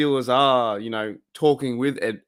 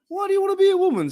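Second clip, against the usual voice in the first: much higher, rushed squeaky voice; fast high falsetto question; 4 dB louder, much faster than usual. Retold or imitated. Fan replaying a Life of Brian skit, fast squeaky high question. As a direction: rattle it off in a squeaky mock voice. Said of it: "And he's like".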